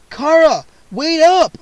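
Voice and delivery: loud, high call while running out of breath; breathless call. A narrator's reading. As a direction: call out to her, winded from running. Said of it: "I called breathlessly".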